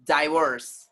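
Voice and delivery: divorce as "die-VORCE"; long eye vowel opening divorce, falling; American pronunciation. Shown as a demonstration.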